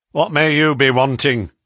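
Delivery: loud Northern English woman; northern accent. A narrator's reading. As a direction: ask it loudly in a broad northern accent. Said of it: "she asked in a northern accent".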